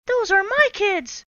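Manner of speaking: high, loud scratchy outburst. Acted. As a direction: rasp it out in shock. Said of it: "she said in a scratchy voice".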